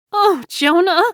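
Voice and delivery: high, loud anguished cry; grieving woman crying her friend's name. Acted. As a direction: cry out his name in grief. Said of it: "Jo Beth cried".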